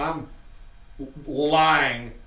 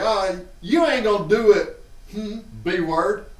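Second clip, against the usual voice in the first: taunting dare; 7 semitones higher, 5 dB louder than usual. Retold or imitated. Teen daring sister.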